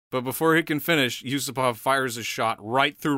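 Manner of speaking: usual speaking voice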